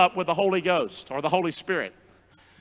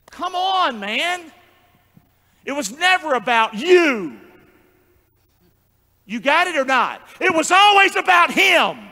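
loud, raised preacher's challenge; forceful preaching; 5 semitones higher, 4 dB louder than usual. Real, not acted. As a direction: drive it home to the congregation.

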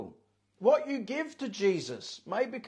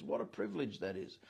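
soft low echo; 12 semitones lower, 9 dB quieter than usual. Shown as a demonstration. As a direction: repeat "What a privilege that is" much softer and lower.

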